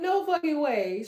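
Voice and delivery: usual speaking voice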